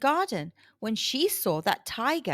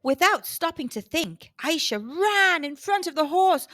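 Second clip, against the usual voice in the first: ordinary delivery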